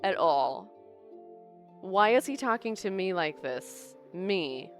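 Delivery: ordinary talk, nothing marked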